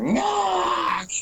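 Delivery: high strangled goblin scream, chopped off; cut-short scream. Acted. Goblin killed in the bushes, high scream cut short. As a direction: shriek high, then stop dead. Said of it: "a scream that just cuts short".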